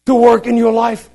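high, loud pulpit proclamation; forceful preaching. Acted. Preacher exhorting the congregation about God's power, high and forceful. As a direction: preach it out, punching each phrase high.

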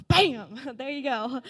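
loud, laughing mock announcement; a bright burst, then a laugh; playful loud exclamation. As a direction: throw it out like a punchline with a laugh. Voicing God's sudden answer.

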